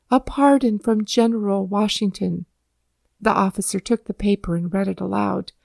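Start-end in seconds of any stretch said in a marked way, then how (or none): none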